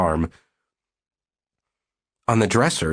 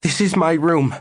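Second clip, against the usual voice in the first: higher, louder breathless claim; panted protest; 9 semitones higher, 3 dB louder than usual. Acted. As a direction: hold your ground while panting. Susie defends her room, panting.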